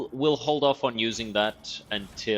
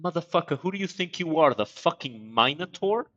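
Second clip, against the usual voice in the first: indignant outburst; 7 semitones higher than usual. Real, not acted. Outraged reaction to the decree.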